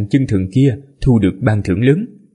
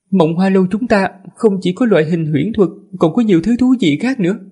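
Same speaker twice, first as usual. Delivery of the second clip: high feminine attendant voice; man doing a woman's voice; 8 semitones higher than usual. Acted. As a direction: voice the servant as a woman, pitched up. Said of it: "Lát ra giả giọng nữ".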